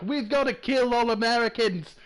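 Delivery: a weak try at Scottish, shouted at Americans; Scottish accent. Pretend. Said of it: "Was that Scottish?".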